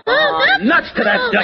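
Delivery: high-pitched burst of frustration; exasperated outburst. Acted. Candy loses patience with the parrot, exasperated outburst. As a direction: blurt it, fed up. Fed up with parrot, told easy.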